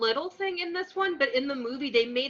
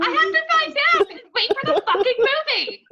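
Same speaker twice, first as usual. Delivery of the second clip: much higher, louder, put-on complaining voice; mock whiny fan; 6 dB louder than usual. Mocking a fan who must wait for the movie, much higher whine.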